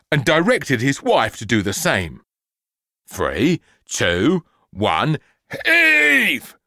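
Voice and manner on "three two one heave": building to a high straining heave; count and heave. Acted. Dad straining to pull his daughter free, shouted.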